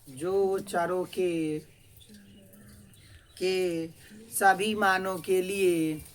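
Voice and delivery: drawn-out classroom dictation, phrase by phrase; slow and projected, with pauses. Real, not acted. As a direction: stretch each word for students writing it down. Teacher dictates for students to write.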